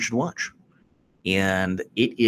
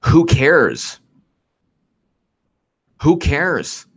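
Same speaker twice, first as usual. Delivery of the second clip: louder, raised, fed up; exasperated rant; 5 semitones higher, 4 dB louder, much slower than usual. Real, not acted. Fed-up host ranting at the audience, raised. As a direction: demand an answer, irritated.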